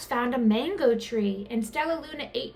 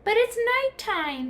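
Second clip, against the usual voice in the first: much higher, squeaky worry; high squeaky protest; squeaking; 11 semitones higher than usual. A narrator's reading. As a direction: squeak it out in alarm. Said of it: "Stellaluna squeaked".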